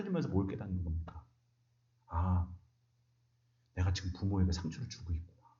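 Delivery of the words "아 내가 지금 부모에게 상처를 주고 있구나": hushed, breathless whisper to oneself; whispered realization. Acted. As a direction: whisper it as a dawning confession. Quiet realization about hurting parents. (속삭이듯 깨닫는 독백)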